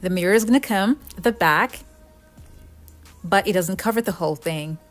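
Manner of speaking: normal talking voice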